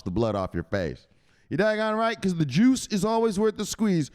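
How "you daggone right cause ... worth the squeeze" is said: high, emphatic skit voice; fired-up agreement. Acted. Skit figure affirming the juice is worth it, fired-up. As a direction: crow it with raised pitch.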